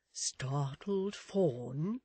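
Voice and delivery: very drawn-out, questioning character voice; tentative suggestion. Acted. Miss Tox supplies another simile, tentative suggestion. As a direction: offer it very slowly, rising at the end. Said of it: "suggested Miss Tox".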